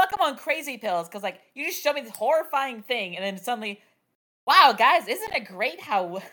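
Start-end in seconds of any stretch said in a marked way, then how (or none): 4.5-6.2 louder, chirpy fake-cheerful exclamation; mock peppy cheer. Mocking the character's sudden peppiness, chirpy and loud. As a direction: overdo it like a bubbly anime girl. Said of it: "Ryoko being all peppy".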